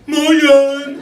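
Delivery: high, very loud dopey yell; hammed-up blaring hello; goofy Luxembourgish greeting. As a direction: blurt it out like a clueless suitor. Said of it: "Second guy goes to her".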